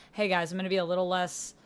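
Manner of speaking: ordinary talk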